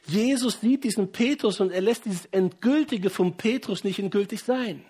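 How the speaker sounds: fervent preaching. Acted. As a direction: preach it out to the whole church.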